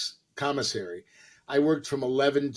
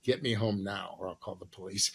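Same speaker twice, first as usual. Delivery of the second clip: imitating cold threat; 7 semitones lower, 8 dB quieter than usual. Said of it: "She looks at me and goes".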